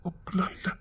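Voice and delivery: soft whispered denial. Acted. Man quietly denying he dislikes her, whispering. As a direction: breathe the denial.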